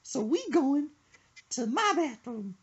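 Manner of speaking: put-on high, fast, squeaky old lady voice. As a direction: mimic her fussy old lady voice quickly. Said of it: "She said".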